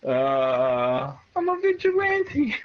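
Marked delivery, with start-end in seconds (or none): 1.3-2.7 high, slow, puffed-up vigilante voice; mock hero voice. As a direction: play a proud would-be superhero, higher and slower. Plays a sword-wielding vigilante.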